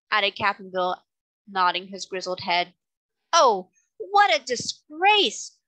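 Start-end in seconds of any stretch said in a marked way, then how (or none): 3.3-5.6 high, slow theatrical lament; moaning. A narrator's reading. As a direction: wail it out in shame, drawn out. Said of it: "moaned the octopus".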